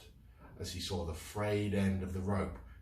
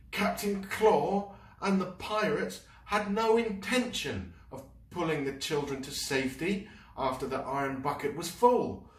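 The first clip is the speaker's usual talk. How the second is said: high, loud outraged narration; indignant; 12 semitones higher, 5 dB louder than usual. A narrator's reading. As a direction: let the anger rise. Fergus's blood boiling, narrated.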